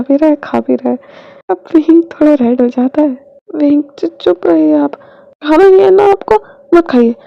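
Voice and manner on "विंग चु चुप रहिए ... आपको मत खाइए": stammered start, then high loud scold; embarrassed scolding. Acted. Blushing Wing scolding Bhang ji, embarrassed loud scold. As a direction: cover the blush by snapping back.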